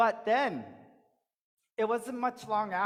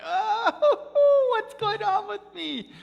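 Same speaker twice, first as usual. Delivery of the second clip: much higher, loud, laughing panic; breathless panic; 13 semitones higher, 9 dB louder, much slower than usual. Acted. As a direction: gasp it out in a frantic laugh. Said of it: "And he went".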